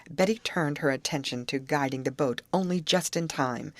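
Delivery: plain reading voice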